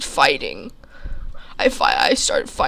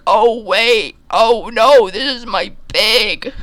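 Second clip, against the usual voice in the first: a hint of a startled farmer, louder; 7 semitones higher, 6 dB louder, slower than usual. Farmer picking the narrator up as his pig, startled. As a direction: exclaim it like spotting your lost pig.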